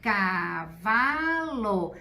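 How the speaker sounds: "cavalo" stretched out very slowly and whole, falling at the end; drawn out slowly. Shown as a demonstration. Teacher saying "cavalo" very slowly so the child hears it whole. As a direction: draw "cavalo" out long, then let it fall. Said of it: "Três sílabas".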